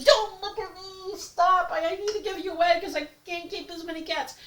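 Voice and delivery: high, pleading mock protest; pleading with kitten. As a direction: beg the kitten to stop looking. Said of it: "you're like".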